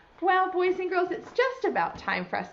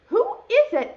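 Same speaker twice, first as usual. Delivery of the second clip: Bear's voice; 13 semitones higher, 9 dB louder than usual. Acted. Bear asks in the story.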